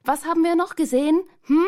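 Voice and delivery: high, loud and fast call; girl shouting at her friend through his helmet; shouted. A narrator's reading. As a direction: yell into the helmet to get his attention. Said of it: "schrie ihm zu".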